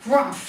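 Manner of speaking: loud grumpy rumph; a grumpy bear's snort of a harrumph; gruff harrumph. A narrator's reading. Big Bear snorts at the little bear, loud grumpy harrumph. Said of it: "said the Big Bear".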